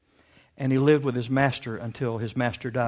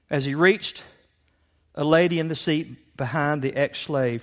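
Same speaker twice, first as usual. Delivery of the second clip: usual speaking voice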